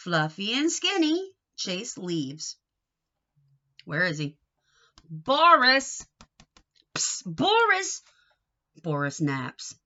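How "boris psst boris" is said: high, loud call. Acted. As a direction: call the kitten out of hiding. Calling the hidden kitten.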